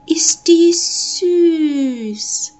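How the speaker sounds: high, drawn-out cooing; delighted little girl. A narrator's reading. Little girl admires the kitten, cooing high. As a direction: gush very slowly, high and loud. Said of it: "sagte das kleine Mädchen".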